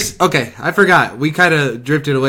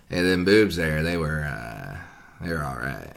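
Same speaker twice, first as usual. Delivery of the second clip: deep dropping voice, trailing quieter; Matthew impression; 9 semitones lower, 8 dB quieter than usual. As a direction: go down low and let it sink away. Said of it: "let me give you my, uh, Matthew".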